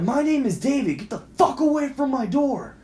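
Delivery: high-pitched retold yell; worked-up, high re-enacted shout; retold scream. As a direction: holler it as if through a door. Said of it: "I'm screaming at my fucking door".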